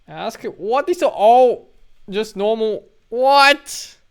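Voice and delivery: high, loud, drawn-out incredulous cry; loud disbelief. Real, not acted. Game player baffled by the choices on offer, loudly incredulous. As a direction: exclaim in baffled outrage.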